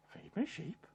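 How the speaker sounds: hushed, high questioning repeat; barely voiced baffled query; puzzled echo. Peter echoes it, puzzled.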